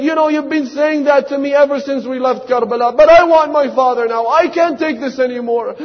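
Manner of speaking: put-on a girl at her limit, begging; child's complaint. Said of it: "She said".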